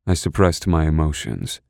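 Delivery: usual speaking voice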